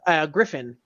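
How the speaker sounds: ordinary talk, nothing marked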